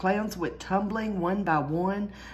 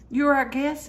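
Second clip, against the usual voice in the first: fake friendly; 7 semitones higher, 5 dB louder than usual. A narrator's reading. Fake-friendly circus owner.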